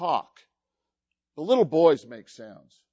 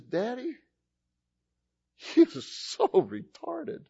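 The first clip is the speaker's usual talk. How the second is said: put-on high, slow, half-whispered little-girl complaint; imitated disgusted daughter; 9 semitones higher, 3 dB quieter, slower than usual. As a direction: imitate a scrunched-up face complaining to Daddy. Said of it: "very slowly her face would just scrunch".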